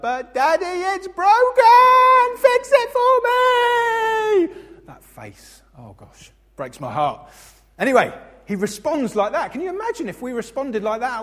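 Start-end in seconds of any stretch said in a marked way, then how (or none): 0.0-4.8 put-on high, shrill-pitched child wail, louder and slow; whining toddler. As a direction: cry out like a little boy over a broken biscuit. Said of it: "he's like".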